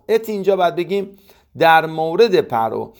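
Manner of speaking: ordinary delivery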